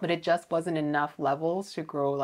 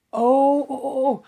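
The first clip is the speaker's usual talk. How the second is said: excited; 9 semitones higher, 10 dB louder than usual. Real, not acted. Sudden excited outburst at the plant.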